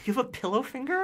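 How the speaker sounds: high, disbelieving question; incredulous. Retold or imitated. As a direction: squeak it out in disbelief.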